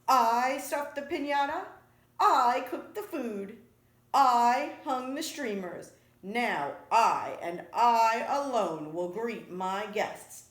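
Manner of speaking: loud, emphatic indignation; acted anger, building in volume; angry. A narrator's reading. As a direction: hammer each "I" in a rising scolding list. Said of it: "whipped her tail around in angry circles".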